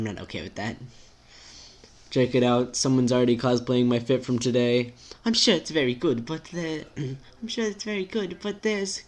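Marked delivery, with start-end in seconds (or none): 5.3-9.0 timid friend. Acted. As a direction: object gently in a higher voice.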